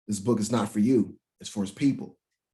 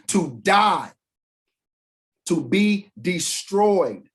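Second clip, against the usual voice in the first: louder, slow and driving "to be destroyed"; emphatic shout; 7 semitones higher, 5 dB louder, much slower than usual. Real, not acted. Preacher shouting the meaning of perish to the congregation. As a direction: hammer home the answer.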